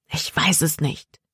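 plain reading voice

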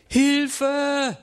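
high, drawn-out cry for help. A narrator's reading. Girl sinking in icy water, cry for help. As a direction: call out high in acted panic. Girl breaks through the ice.

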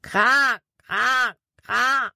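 convincing crow caw imitation; crow call. A narrator's reading. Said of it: "Erneut schrie Jordan".

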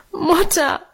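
high tearful cry. A narrator's reading. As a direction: call out to mother in tears. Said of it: "rief Minchen und fiel ihr weinend".

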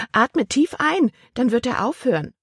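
a touch of a fussy aunt giving orders. Acted. Aunt voiced in story.

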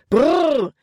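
high, loud whoa; shouted command. A narrator's reading. Nate tries to stop the racing horses, shouting. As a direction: yell at bolting horses to stop, pitch way up. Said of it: "schrie Nate erneut".

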